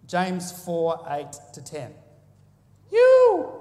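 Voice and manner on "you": sudden loud high shout; calling out. Real, not acted. Preacher singling out a listener, shouting high and loud. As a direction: pick someone out of the crowd, loud.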